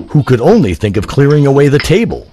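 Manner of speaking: ordinary delivery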